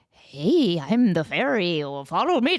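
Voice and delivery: a repeat of the low take; low fairy voice again. Shown as a demonstration. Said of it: "the same as the second one".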